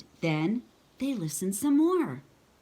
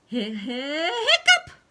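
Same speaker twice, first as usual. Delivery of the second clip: laughing hiccup; 8 semitones higher, 6 dB louder, slower than usual. Acted. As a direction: giggle then a high loud hiccup. Clifford hiccups at vet.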